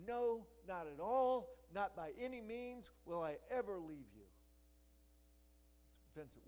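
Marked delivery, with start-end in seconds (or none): 0.1-4.3 God's promise shouted with insistence; preacher booming a divine vow; loud emphatic voicing. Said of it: "He's saying".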